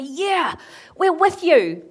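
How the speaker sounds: high, loud shout of support; rousing cheer. Retold or imitated. People capturing his courage, answering in a rousing shout. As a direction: cheer back as a fired-up crowd.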